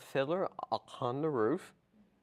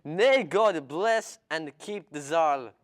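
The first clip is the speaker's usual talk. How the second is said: Tevye-style Russian accent, slow and loud; 4 semitones higher, 8 dB louder, slower than usual. Shown as a demonstration. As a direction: bless the Tsar in a heavy Russian accent. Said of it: "go ahead and show us a little bit of that".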